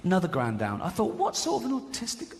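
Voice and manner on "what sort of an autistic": confused. Retold or imitated. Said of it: "I was confused".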